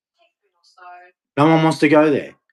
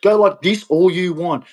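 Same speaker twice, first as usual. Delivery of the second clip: ordinary talk, nothing marked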